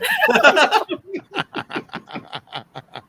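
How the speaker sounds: high-pitched drawn-out laughter; long laughing fit. Real, not acted. Laughing at a friend's pigeons-on-his-feet joke, long high laughing fit. Reaction to pigeons perching story.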